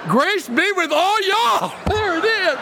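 very high, loud, jubilant cry; gleeful shout. Real, not acted. Pastor reading the letter's end as all y'all, gleeful high shout. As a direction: shout it out high, delighted by the joke.

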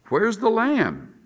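put-on high, slow, drawn-out boyish question; a puzzled son's voice sliding up high; asking boy. Boy asks his dad about the sacrifice, high puzzled question. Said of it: "The boy is asking".

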